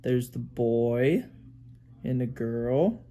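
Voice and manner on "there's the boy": ordinary delivery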